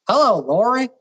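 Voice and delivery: high, swooping Alabama greeting; lightly put-on Alabama country voice; Alabama accent. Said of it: "the Alabama one".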